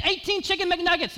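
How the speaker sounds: high, mock-yelled order; server insisting. Server repeating the order back to the customer, high mock yell. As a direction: fire it back like a tired cashier. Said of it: "And she said again".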